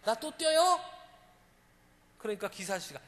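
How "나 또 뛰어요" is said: put-on eager child. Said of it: "아이가 아저씨".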